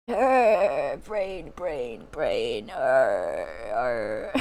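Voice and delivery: low groaning zombie moans. Pretend. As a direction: moan like a hungry zombie. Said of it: "Zombie Cree voice pack, do it".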